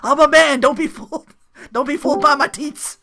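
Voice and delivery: high, loud mock protest; mock indignant voice. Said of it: "She's like".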